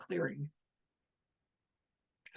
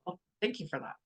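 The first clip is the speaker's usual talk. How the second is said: ordinary talk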